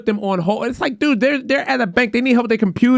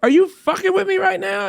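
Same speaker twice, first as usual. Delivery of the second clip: much higher, incredulous caller voice; exasperated disbelief; 12 semitones higher than usual. Retold or imitated. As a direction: challenge the agent in high-pitched exasperation. Retold caller losing patience.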